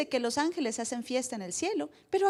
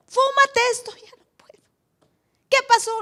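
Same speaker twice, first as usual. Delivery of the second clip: much higher, louder pushy friend; 11 semitones higher, 9 dB louder than usual. Retold or imitated. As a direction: badger him loudly. Friends pushing convert. (amigo insistente, agudo y fuerte)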